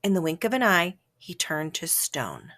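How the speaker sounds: usual speaking voice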